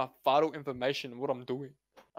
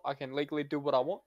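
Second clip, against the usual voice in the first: ordinary talk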